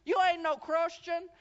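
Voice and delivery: high, loud, slow scornful yell; hammed-up accusing shout; mock angry shout. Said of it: "And so she said".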